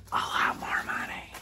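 hushed aside to the audience; conspiratorial whisper. Acted. Comic teacher whispering a secret about money.

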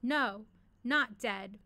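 loud, slow, emphatic declaration; shouted. Acted. Dead-alive creature defying the apprentice, loud shout. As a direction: shout it out, drawing each word out. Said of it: "the once Gungosa shouted".

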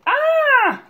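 high, loud granny yell; startled high scream. A narrator's reading. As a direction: yell out in alarm. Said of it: "yelled Granny".

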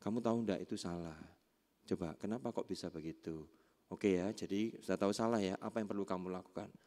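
low, soft questions; gentle parental coaching. Acted. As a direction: guide a child quietly through a mistake. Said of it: "misalkan anak".

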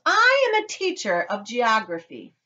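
slow, high, loud declaration; indignant. A narrator's reading. Mrs. Salt correcting Mister Wonka, indignant. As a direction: stretch out the protest, pitched high.

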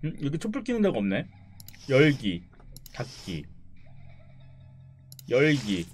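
ordinary talk

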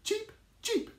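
high squeaky chick cheeps; bird cheeps. Acted. Teacher voicing baby birds for the children. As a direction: peep it like a tiny bird. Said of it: "Three little birds say".

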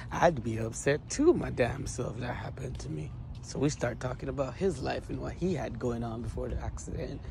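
ordinary delivery

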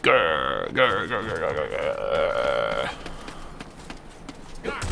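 low, soft pretend growls; mock growling. Gamer fakes low angry growls about getting angry. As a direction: growl like a grumpy animal. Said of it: "I'm gonna get angry".

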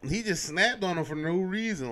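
usual speaking voice

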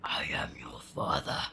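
whispered, breathy villain line; Darth Vader whisper. Retold or imitated. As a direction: hiss it like a masked villain. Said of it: "Darth Vader".

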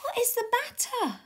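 high-pitched anxious question; calling out. Acted. Sam asks the panicking bird, high and urgent. Sam calls to alarmed warbler.

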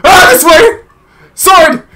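high, loud yelping; loud, high-pitched scramble; frantic gamer panic. Real, not acted. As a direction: shout moves while being chased. Frantic play while being chased.

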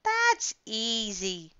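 slow, changed big-creature voice; lightly voiced easygoing dinosaur. A narrator's reading. Dinosaur answers the children, slow and easy. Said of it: "said the dinosaur".

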